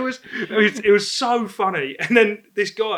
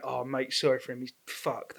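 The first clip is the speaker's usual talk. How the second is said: quiet apology; 6 semitones lower, 12 dB quieter than usual. Retold or imitated. Friends apologising for drunk mate.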